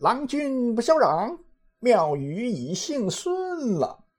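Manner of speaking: high-pitched, drawn-out calling out; theatrical high cry from a flustered character; Monkey King's urgent cry. Acted. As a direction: call it out urgently, far up in pitch, stretching the end. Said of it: "情急之下高声叫道".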